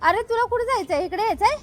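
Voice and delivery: high pet-talk questions. Real, not acted. Owner to dog, asking where it wants to go, high baby talk.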